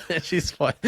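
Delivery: ordinary talk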